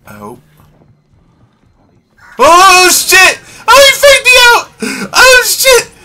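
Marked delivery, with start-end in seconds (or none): 2.4-5.9 high panicked yelling; being chased. Real, not acted. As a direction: scream while fleeing in terror.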